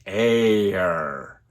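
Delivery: "air" with a gliding English diphthong. Shown as a demonstration. Teacher showing the English air diphthong, pronunciation demo. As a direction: glide through "air" as one diphthong. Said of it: "только в дифтонге".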